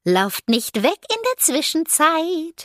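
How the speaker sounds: put-on high, slow, lilting ghost child; fluting ghost girl. A narrator's reading. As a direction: flute it like an eerie little ghost. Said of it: "flötete Tatze".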